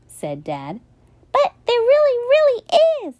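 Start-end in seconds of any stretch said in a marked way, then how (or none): 1.3-3.2 put-on high, louder pleading child voice; boy pleading to be believed; insistent child.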